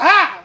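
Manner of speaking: loud frightened yelp; startled yelp. Retold or imitated. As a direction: cry out in sudden fright. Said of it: "I was".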